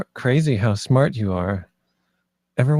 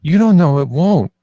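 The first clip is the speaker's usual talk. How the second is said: louder, higher angry comeback; heated retort; 5 semitones higher, 7 dB louder than usual. Acted. Friend argues hotly that they could get hurt. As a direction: fire it straight back at him.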